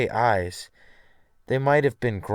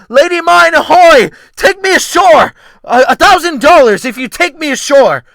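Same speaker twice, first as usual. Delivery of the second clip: yelled at full force, much higher and louder; desperate shout; 16 dB louder than usual. Acted. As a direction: hail a passing boat across the water, desperately. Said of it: "waving my arms and shouting".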